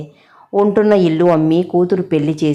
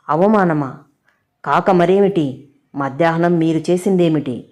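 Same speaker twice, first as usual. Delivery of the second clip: plain reading voice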